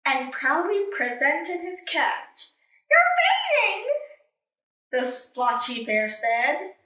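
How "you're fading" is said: surprised cry. Acted. Panda surprised.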